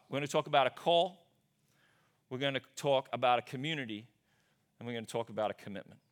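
ordinary talk, nothing marked

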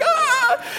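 very high, short mock shriek of a hurt kid; mock high-pitched child yelp; imitated child's scream. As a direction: squeal it like the little girl. Sister yells at being headbutted.

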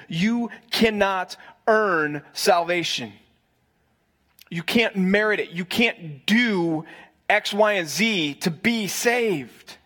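slow, weighty "cannot earn salvation", word by word; emphatic stress. Real, not acted. Preacher driving the point home to his congregation, slow and emphatic. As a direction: spell it out slowly with pauses, stressing each word. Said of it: "I want to stress".

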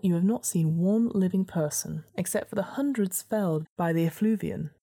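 usual speaking voice

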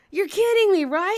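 high, loud disbelief; incredulous. Retold or imitated. Storyteller questions partner's revelation, high incredulity. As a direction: beg to be told it's a joke.

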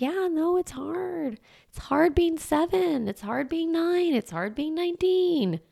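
high, gentle acknowledgement; soothing. Retold or imitated. Parent soothing a struggling child, high and gentle. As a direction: comfort the kid, gently.

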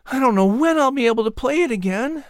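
put-on high boyish voice, wistful; a young lad downcast about his injured shoulder; high-pitched boy, a little sad; young Patrick. Narrator voicing young Patrick.

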